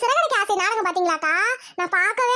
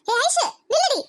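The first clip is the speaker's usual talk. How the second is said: higher sharp call to stop her; 4 semitones higher than usual. Acted. Mother stops daughter coming home late, sharp call. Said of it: "ஏம்மா இப்படி கத்துறீங்க?".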